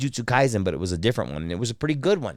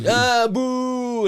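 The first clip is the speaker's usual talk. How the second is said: high, drawn-out jeer; background crowd booer; 13 semitones higher than usual. Shown as a demonstration. Voice actor showing background crowd voices, high jeering. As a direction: boo like one face in an anime crowd. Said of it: "some of the voices you do".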